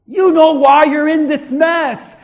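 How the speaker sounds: loud preacher's rebuke; confronting shout. Real, not acted. Preacher confronting listener about the mess, loud rebuke. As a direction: call them out at full volume.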